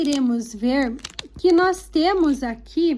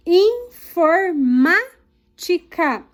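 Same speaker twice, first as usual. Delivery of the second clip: informática slow, in pieces, MÁ strong; drawn-out "informática", stress on "má"; stressed syllable demo; 3 dB louder, much slower than usual. Shown as a demonstration. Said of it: "pinte a sílaba tônica".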